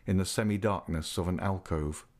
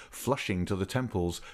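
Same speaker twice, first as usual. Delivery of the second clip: ordinary delivery, nothing marked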